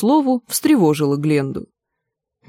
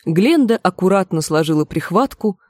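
plain reading voice

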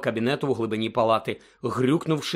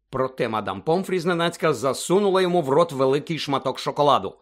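ordinary delivery, nothing marked

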